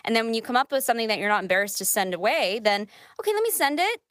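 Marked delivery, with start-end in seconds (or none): 3.2-4.0 upbeat high voice. Retold or imitated. Self-quote of sending an audition.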